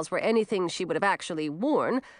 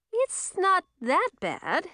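high, slow, drawn-out tease; slow singsong reassurance, pitched up; teasing reassurance; 10 semitones higher, slower than usual. A narrator's reading. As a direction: reassure with an audible grin.

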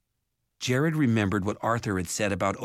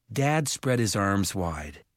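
plain reading voice

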